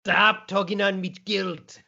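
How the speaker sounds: slow, put-on Scottish brogue; co-host playing along in mock Scottish; Scottish accent. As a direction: answer the joke in a thick Scottish voice. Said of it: "Karate Kid was done in a Scottish accent".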